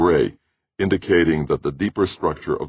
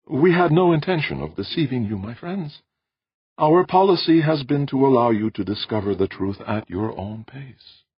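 apologetic host, pitched well above the narration; placating Audrey; 10 semitones higher than usual. Acted. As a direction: gently explain in a lifted, careful voice.

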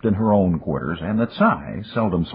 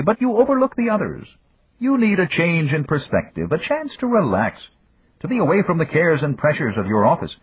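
Yamagata raising voice; 12 semitones higher than usual. A narrator's reading. Yamagata raising his voice to silence Dan, higher and firmer. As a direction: cut off his friend with gentle authority. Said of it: "raised his voice enough".

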